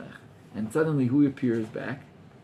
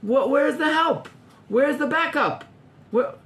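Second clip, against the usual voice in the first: calling for help; 13 semitones higher, 4 dB louder than usual. Acted. Overworked gatherer calls out for help and backup, exasperated plea. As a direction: call out high and stretch the words.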